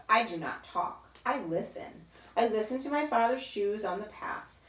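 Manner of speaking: ordinary delivery, nothing marked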